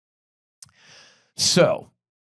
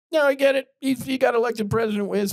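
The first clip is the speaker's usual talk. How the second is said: sarcastic outburst; 13 semitones higher, faster than usual. Acted.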